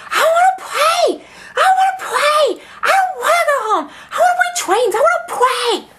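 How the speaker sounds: put-on very high, wailing child's shout; tantrum kid impression. Daughter refusing to go home from the trains, mock tantrum wail. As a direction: screech it like a kid mid-tantrum. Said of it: "Kid's like".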